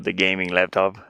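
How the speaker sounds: ordinary talk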